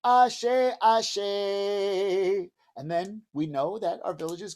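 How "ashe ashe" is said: drawn-out louder reply; faraway village reply; calling back from far away. Shown as a demonstration. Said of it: "your village would say".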